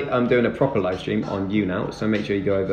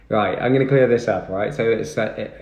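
ordinary delivery

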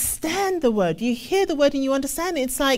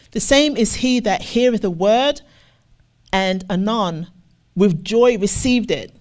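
scripture reading; 5 dB louder than usual. Acted. Preacher reading the parable to the congregation, measured and deliberate. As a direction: read the verse out to the congregation.

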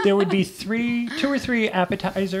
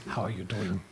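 low, soft, badly faked French accent; bad French accent, pitched way down and quiet; horrible French accent; 10 semitones lower, 12 dB quieter than usual. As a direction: murmur it in a hammy French accent. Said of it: "That was a horrible French accent".

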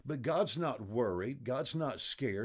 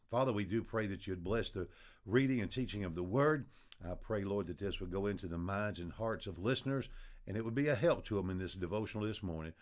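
lower, hushed prayer voice; closing prayer; 4 semitones lower than usual. Acted. Preacher praying over his listeners, low and hushed.

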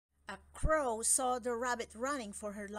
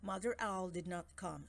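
ordinary delivery, nothing marked

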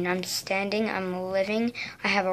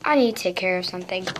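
ordinary talk, nothing marked

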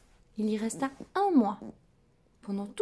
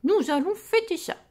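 excited; 8 semitones higher, 8 dB louder than usual. A narrator's reading. Doctor proposes celebration.

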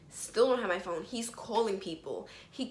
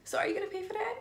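mock cashier; 11 semitones higher, much faster than usual. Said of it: "He's gonna ask me".

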